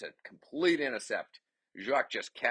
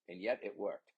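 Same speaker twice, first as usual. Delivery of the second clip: ordinary talk, nothing marked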